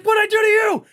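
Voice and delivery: high, loud, aggrieved shout; angry. Retold or imitated. Man in line to biker who cursed him, angry shout. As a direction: yell after someone who just insulted you. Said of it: "I got fucking angry".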